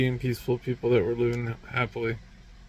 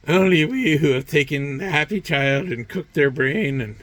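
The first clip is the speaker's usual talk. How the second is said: higher, smug mock voice of scheming elites; lightly hammed-up villain boasting, getting louder at the end; smug cunning elites; 4 semitones higher, 5 dB louder than usual. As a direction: sneer it like a self-satisfied puppet master. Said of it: "so cunning in their".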